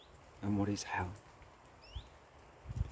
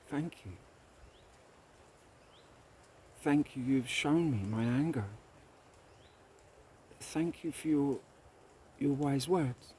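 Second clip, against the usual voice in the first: humbled grateful king; 5 semitones higher than usual. Acted. King humbled, thanks wise man.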